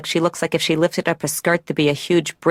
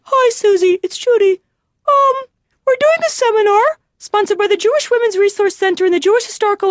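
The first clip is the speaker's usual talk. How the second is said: put-on much higher, louder nasal-sounding cross of Kermit and Julia Child; 14 semitones higher, 4 dB louder than usual. Patient imitating cousin Judy phoning her, Kermit-Julia Child voice. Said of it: "cross between Kermit and Julia Child".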